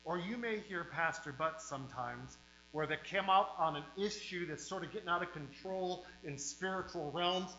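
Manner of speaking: usual speaking voice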